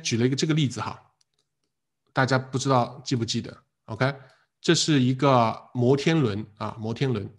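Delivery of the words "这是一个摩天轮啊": ordinary talk, nothing marked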